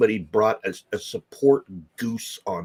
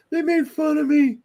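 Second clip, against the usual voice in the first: put-on much higher, louder whiny child voice; whiny kid; 5 dB louder than usual. A teased kid whining to his mom, high and louder.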